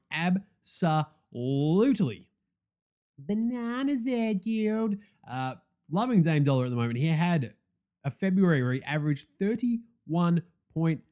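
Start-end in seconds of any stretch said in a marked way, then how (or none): 0.1-5.0 stretched, very slow build to a high "bananas"; slow then high, amazed; hyped up. Real, not acted. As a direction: drag it out, then pop up high in awe. Hyped praise of player.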